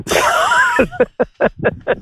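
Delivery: sudden high laugh at own punchline; high burst of laughter. Real, not acted. Laughing at his own Johnny Depp punchline.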